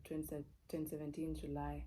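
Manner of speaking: usual speaking voice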